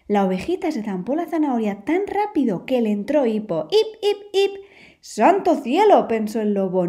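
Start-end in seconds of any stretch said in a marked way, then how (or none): 3.7-4.5 high squeaky hiccup sounds; little lamb hiccuping in a high voice. Acted. Lamb gulped the carrot, now squeaky high hiccups. 5.0-6.1 slow, loud "¡Santo cielo!" in alarm; old wolf exclaiming in alarm; loud exclamation. Acted. Wolf alarmed by the hiccuping lamb, slow loud exclamation.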